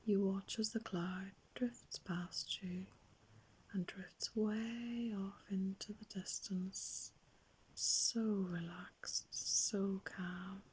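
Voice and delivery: hushed, drawn-out, soothing meditation voice; guided meditation. Acted. Adult guiding children to watch clouds drift by, very soft soothing meditation. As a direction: softly lull them, stretching words and leaving long pauses.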